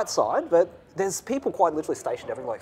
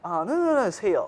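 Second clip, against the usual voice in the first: excited fan; 7 semitones higher than usual. Retold or imitated. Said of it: "so I was like".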